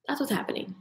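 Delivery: soft breathy whisper of a secret. Acted. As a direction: lean in and whisper it like a tease. Teasing aside after the class list.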